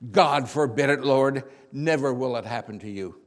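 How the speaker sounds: very loud, forceful outburst; yelling in protest. Acted. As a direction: shout an urgent objection at your master. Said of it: "started yelling at him".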